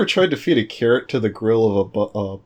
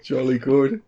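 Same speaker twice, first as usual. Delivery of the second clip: mock British accent; slower than usual. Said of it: "Jolly hell".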